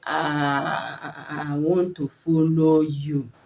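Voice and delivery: drawn-out "Ah", then stuttering on "I, I, I"; long droning block on "Ah" breaking into a repeated "I"; child's stammer. Shown as a demonstration. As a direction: imitate a child struggling to get past "I". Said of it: "your child will tell you".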